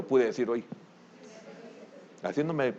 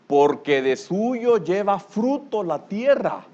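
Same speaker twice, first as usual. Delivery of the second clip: slow, solemn reading of the verse; each word of "Porque de suyo lleva fruto la tierra" drawn out slowly; solemn scripture reading; 6 semitones higher, 4 dB louder, much slower than usual. Shown as a demonstration. Preacher reads the verse slowly to the congregation. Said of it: "ah, mire qué tremendo".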